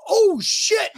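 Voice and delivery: very high mock-panicked teen; high alarmed mock voice of the son. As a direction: squeak it like a kid who just saw the clock. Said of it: "he goes".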